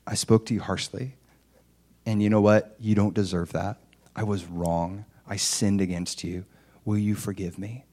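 low, soft, humble confession; sincere apology. Retold or imitated. Husband asking his wife's forgiveness, sincere and humble. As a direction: own the fault quietly, asking forgiveness. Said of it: "none, none of that".

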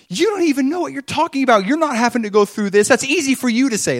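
high, loud, rapid outburst; panicked retort. Retold or imitated. Wife answering the friend who comforts her, panicked retort. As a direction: throw it back at her in rising panic. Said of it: "panicking in the moment, you know, the Ushi responds".

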